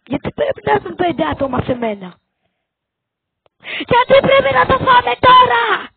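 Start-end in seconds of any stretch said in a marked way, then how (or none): 3.9-5.9 loud, high outraged cry at the screen; frustrated yell. Real, not acted. Gamer yelling at his team in frustration, loud. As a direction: shout it out in disbelief.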